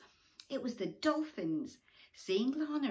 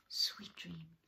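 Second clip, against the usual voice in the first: whisper. Acted. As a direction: breathe it gently. Hushed bedtime words.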